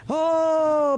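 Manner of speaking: ordinary talk, nothing marked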